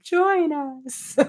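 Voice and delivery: high, drawn-out spooky invitation; mock-eerie, high-pitched beckoning; Evil Dead line. Co-host beckoning a chat viewer to join, playful horror-film voice. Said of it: "that's an Evil Dead Two line".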